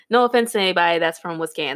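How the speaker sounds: ordinary talk, nothing marked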